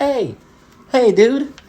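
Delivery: high, loud hey dude; mock call out. Someone shouting at the brawlers to calm down, high loud call. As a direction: call out to stop them.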